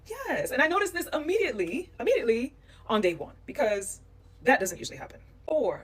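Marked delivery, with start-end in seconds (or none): 0.1-0.5 high-pitched, approving yes; delighted yes. Retold or imitated. Woman retelling her reaction to a courteous man, delighted. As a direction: squeal a little yes in approval.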